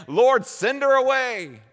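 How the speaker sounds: loud, high and slow dismissal; impatient apostles. Acted. Apostles telling Jesus to send the woman away, loud impatient plea. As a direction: wave her off, drawn out and loud. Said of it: "the apostles say".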